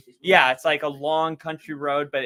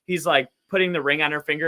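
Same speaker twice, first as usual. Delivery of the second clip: usual speaking voice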